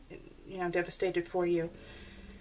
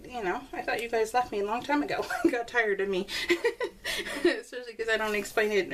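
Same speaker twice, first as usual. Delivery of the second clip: ordinary talk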